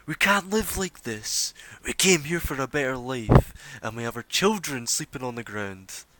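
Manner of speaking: rally the crowd with a desperate cry; a mob voice demanding a better life; angry protester. Acted. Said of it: "you just hear, coming from the front of the crowd".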